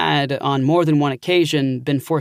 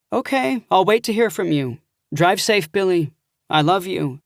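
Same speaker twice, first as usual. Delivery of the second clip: higher, tender goodbye; fond aunt; 4 semitones higher than usual. Acted. Aunt ending the call with her nephew, fond farewell. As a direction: send him off lovingly.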